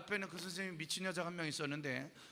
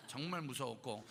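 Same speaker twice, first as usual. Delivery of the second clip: ordinary talk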